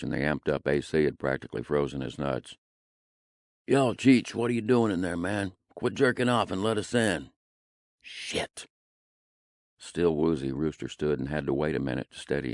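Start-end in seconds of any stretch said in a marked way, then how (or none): 3.6-7.3 loud, high calling out; shouting through a door. Acted. Friends pounding on door, calling. 8.0-8.7 hushed whispered swear; whispered curse. Acted. Waking up to pounding.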